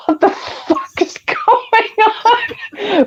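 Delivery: high, slow, laughing disbelief; mock bewildered, laughing; baffled laughing. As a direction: laugh through total confusion. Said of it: "I was like".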